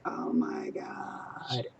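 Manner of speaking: low, slow drawn-out oh my God; overwhelmed. Retold or imitated. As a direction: let it sink in slowly. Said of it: "I was like".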